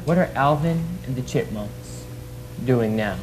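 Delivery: very low, serious interviewer voice; deep interviewer voice. Acted. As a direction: drop the voice way down like a TV host.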